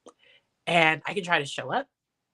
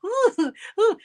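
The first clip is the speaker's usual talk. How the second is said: squeaky high "Ooh, ooh" of being caught out; a sheepish, hammed-up high ooh; mock high ooh; 5 dB louder than usual. Mom caught out by her husband, high sheepish ooh.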